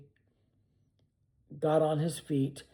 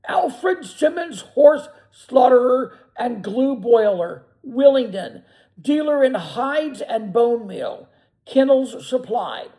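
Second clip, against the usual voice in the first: high, loud and slow; loud dire reading; 7 semitones higher, 9 dB louder, slower than usual. A narrator's reading. Benjamin reading the van's lettering aloud, slow and loud. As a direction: read each word out like a verdict. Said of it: "then in the midst of a deadly silence, he read".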